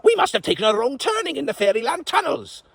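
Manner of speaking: panicked lord. Acted. Basil continues.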